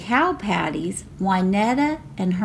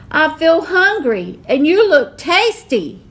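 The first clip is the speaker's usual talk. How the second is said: loud, higher hungry roar; giant roaring; 8 semitones higher, 7 dB louder than usual. A narrator's reading. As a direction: roar it with greedy relish. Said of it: "he roared".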